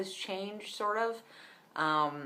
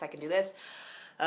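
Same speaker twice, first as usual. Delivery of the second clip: forced voice crack; 4 semitones higher, 8 dB louder, much faster than usual. Shown as a demonstration. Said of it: "if you force your voice".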